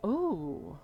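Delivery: ordinary talk, nothing marked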